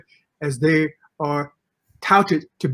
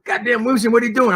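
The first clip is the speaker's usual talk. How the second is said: coach shouting; 7 semitones higher, faster than usual. Retold or imitated. Coach running over to the rookie, retold outraged shout. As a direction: throw up your hands like the coach. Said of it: "Coach comes running over and says".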